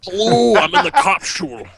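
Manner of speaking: Scott Manley. Acted. Co-streamer piling onto the impression, mock Scottish accent. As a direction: imitate a Scottish space-game streamer. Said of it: "this is Scott Manley".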